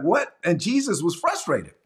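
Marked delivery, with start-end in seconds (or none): 0.0-0.4 higher, louder startled "What?"; a sharp incredulous question; mock disbelief. Jesus reacts to complaining disciples.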